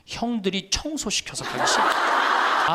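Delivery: slow, laughing mock grumble of an old man; a hint of a sulky elderly father, drawn out; grumpy old father. Priest imitates his father complaining about elder bullies, slow and laughing.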